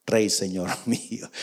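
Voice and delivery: soft, slow, humble reply; subdued, reverent acted voice; respectful. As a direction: answer the king low and deferential. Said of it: "El respeto que le tenía David".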